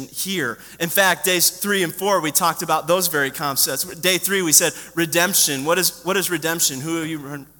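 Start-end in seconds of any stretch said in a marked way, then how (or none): none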